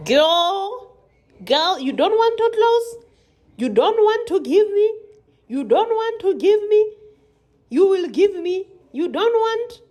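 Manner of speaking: high, loud mock scolding of a sticky toothpick; sassy scolding voice. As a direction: scold the stubborn thing like a sassy auntie. Voicing a scolding to stuck toothpick.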